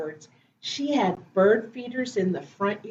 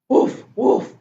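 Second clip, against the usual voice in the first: short imitation woofs; quick, playful mock barking; puppy bark; 4 semitones higher, 4 dB louder than usual. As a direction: bark like a little dog. Story reader barks as puppy Biscuit.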